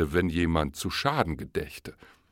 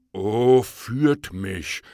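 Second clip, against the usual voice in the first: an eager bear crying out, louder; the bear cries out, hungry for honey; 4 semitones higher, 7 dB louder, much slower than usual. Acted. Bear pleads with the fox to lead him, eager loud cry. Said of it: "rief er".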